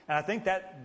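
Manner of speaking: ordinary talk, nothing marked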